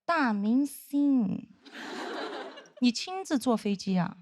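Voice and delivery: imitating a slow, drawn-out sarcastic greeting; passive-aggressive. Senior colleague meets the former crew member, drawn-out sarcasm. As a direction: stretch the words with snide mock deference. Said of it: "非常阴阳说".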